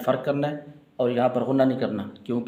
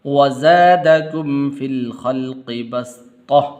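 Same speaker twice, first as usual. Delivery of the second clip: melodic chanted recitation; Quran recitation; 6 dB louder, much slower than usual. Real, not acted. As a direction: chant each verse slowly with melody. Teacher recites Quran verses.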